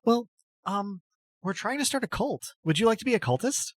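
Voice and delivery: shyly asking someone to join a cult; timid cult recruiter. Acted.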